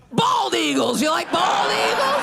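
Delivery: high, slow, shouted blurt; a hammed-up nervous shout; blurted outburst. Blurting intrusive thought.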